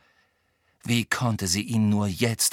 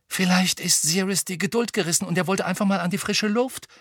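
higher, mildly worried suggestion; uneasy; 8 semitones higher than usual. A narrator's reading. Ron guesses Sirius went out, sounding uneasy. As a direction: offer the idea with a touch of concern. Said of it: "und klang beunruhigt".

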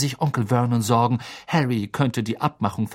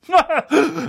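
loud, high laugh; hearty laugh; 4 dB louder than usual. Acted. Uncle laughing at his own remark.

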